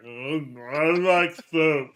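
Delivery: slow, dopey and vacant; dazed dopey voice. Acted. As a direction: drag it out like a dazed fool. Wolverine brain-damaged.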